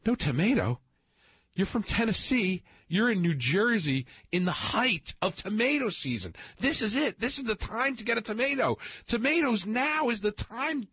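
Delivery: high and slow, bursting with tomato enthusiasm; really excited. Retold or imitated. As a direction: replay your own giddy excitement, voice soaring. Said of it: "really excited about the tomato".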